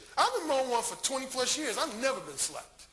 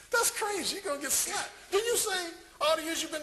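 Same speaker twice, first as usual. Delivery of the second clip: high-pitched imitated disbelief; mock alarm; 8 semitones higher than usual. Brother warning him about his bluntness, high mock alarm. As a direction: jump way up in pitch, incredulous.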